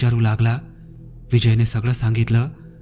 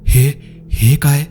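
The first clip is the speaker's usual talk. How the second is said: slow, halting startled question; mild stunned disbelief, drawn out; breath-caught alarm; 3 semitones higher, 4 dB louder, slower than usual. A narrator's reading. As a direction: falter on the words, taken aback. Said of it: "विजयचा श्वास अडकला".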